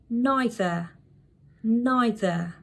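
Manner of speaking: "neither" as NY-thuh; British pronunciation. Shown as a demonstration. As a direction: say "neither" with an eye sound first. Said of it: "You see how I'm pronouncing this word?".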